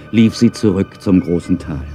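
ordinary delivery

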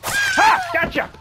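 shrieking gotcha; gotcha yell. Real, not acted. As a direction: jump out and scream gotcha. Jump scare on mom.

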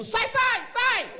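high, loud mock-panicked yell; grito desesperado. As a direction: shout people out of the way in comic desperation. Corre desesperado para o embarque. (grito agudo de desespero encenado)

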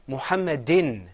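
"Muhammadin" loud, ending in a clear in; teacher modelling "Muhammadin"; model pronunciation. Shown as a demonstration. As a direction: project "Muhammadin" for a class to repeat. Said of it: "Say it with me".

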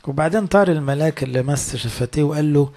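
ordinary talk, nothing marked

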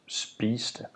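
"spiste" slow and soft, P as B, T as D; spiste, softened. Shown as a demonstration. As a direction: soften both stops. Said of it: "rule for P and T both applies".

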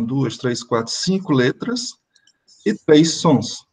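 ordinary delivery

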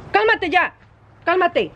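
high, sharp "cálmate" at the jumping dog; dog owner shouting high commands at a lunging dog; urgent command. Real, not acted. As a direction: order the dog to calm down now.

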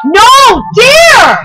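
sudden high yell of protest; shouting. Acted. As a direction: cry it out in alarm. Toy couple quarrel, crash.

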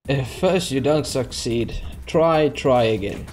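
slow, drawn-out saying; slow mock-wise proverb; slow proverb recital. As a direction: recite it slowly like a wise motto.

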